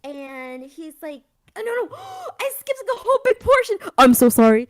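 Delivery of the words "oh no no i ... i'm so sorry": loud, high, flustered cry of dismay; real startled shout of apology; ear-splitting outburst. Real, not acted. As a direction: blurt it out at full volume, panicked at the slip. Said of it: "Oh, God, my ears".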